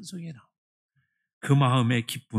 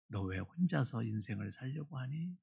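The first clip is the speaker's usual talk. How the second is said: gentle voice of the Lord; 3 semitones lower, 11 dB quieter than usual. Retold or imitated. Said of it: "주님이 갑자기 이렇게 말씀하시더라고요".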